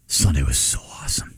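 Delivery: put-on whispered and soft, like a kid's secret; son's whisper. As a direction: breathe it out to dad. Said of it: "And then he just goes".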